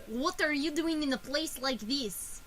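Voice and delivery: put-on Russian accent. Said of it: "Oh, yeah, I'm doing".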